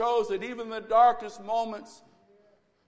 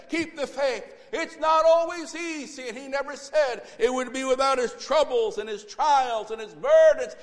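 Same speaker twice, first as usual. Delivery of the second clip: loud, higher, climactic exhortation; full-force preaching; 3 semitones higher, 6 dB louder than usual. Acted. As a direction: drive it home to the congregation.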